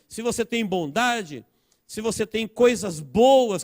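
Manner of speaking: high, insistent sermon delivery; ringing pulpit list, each item punched; impassioned preaching. Real, not acted. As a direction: exhort the congregation with mounting conviction. Preaches at full force.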